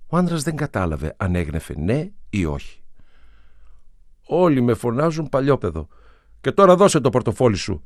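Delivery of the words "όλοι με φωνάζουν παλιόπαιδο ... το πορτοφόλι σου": loud, higher, firm demand that allows no reply; no-argument tone. A narrator's reading. Street kid tells the man his name then demands the wallet, firm. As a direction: insist like a tough kid who won't be refused. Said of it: "είπε με ύφος που δεν σήκωνε αντίρρηση".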